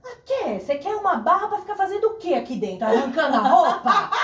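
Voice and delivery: high-pitched, incredulous imitation of dad; hammed-up disbelief, high and rising in volume; mock indignant father. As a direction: play a scandalised father, voice pushed up. Imitates father challenging her about bar.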